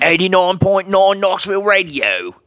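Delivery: high-pitched British radio station ID; mock British accent. Bandmate faking a Knoxville radio ID, high hammed-up British. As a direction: announce it as a cartoon Brit. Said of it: "There he is doing British again".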